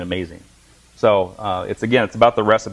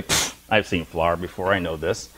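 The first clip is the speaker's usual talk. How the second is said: lower, slow, bored scoff; a student scoffs at the chef, lower and slow; dismissive student; 6 semitones lower, 5 dB quieter, slower than usual. Retold or imitated. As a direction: brush it off as old news. Student dismisses the chef's flour.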